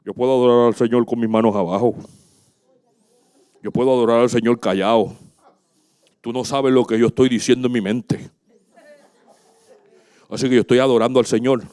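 low, quick monotone of the self-styled spiritual; mock super-spiritual churchgoer. Pastor mocking those who worship silently, mock-pious low voice. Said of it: "Entonces vienen los espirituales".

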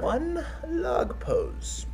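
put-on high, very slow frail old man; old man handing it over. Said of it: "It's this super frail old man".

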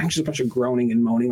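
ordinary delivery

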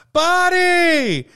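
"Buddy!" yelled high and loud; loud chanted reply; group shout. Retold or imitated. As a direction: shout it back like boys answering a whistle. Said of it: "and they all shout".

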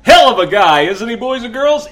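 loud showman hype; ringmaster. Acted. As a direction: bellow it to the crowd. Host hyping guest loudly.

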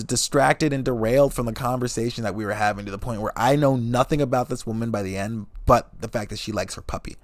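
normal talking voice

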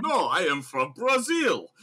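loud, high Russian spy denial; mock Russian accent. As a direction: play a Russian badly passing as Brazilian. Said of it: "a Russian accent".

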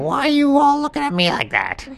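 high, laughing animal voice; giggly little animal asking. Acted. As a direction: squeak it out with a laugh.

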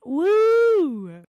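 loud, high-pitched woo; high cheering whoop. Real, not acted. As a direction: whoop it out in celebration. Cheering own quip about girlfriend.

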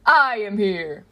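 loud, slow heroic proclamation; booming, stretched-out hero call; All Might. A narrator's reading. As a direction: announce your arrival in a tremendous voice. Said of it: "come All Might's tremendous voice".

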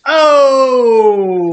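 high, drawn-out shout; loud cheer. Real, not acted. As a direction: holler a long "oh" to hail someone. Greeting producer as he appears.

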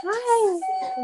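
high coo, as if to a puppy; high-pitched pet greeting. Real, not acted. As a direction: squeal a sweet hi to a dog. Greeting a cute dog.

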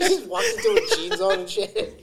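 high, slow words broken by laughter; laughing. Real, not acted. Friend cracking up retelling the gym scene, laughing while talking. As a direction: laugh through the whole line.

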